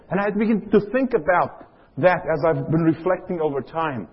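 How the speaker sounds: usual speaking voice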